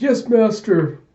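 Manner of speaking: high, loud servant's reply; obedient servant. Acted. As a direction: play an eager minion answering the call. Servant answers his master.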